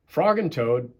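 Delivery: ordinary delivery, nothing marked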